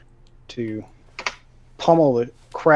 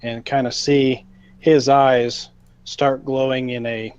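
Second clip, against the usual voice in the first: ordinary talk, nothing marked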